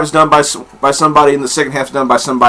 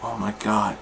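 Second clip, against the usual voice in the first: awed hush; 6 semitones lower, 15 dB quieter than usual. Retold or imitated.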